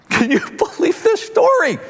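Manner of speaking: high, amazed question broken by a laugh; laughing disbelief. Real, not acted. Preacher to congregation, laughing in amazement. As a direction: laugh at how unbelievable it is.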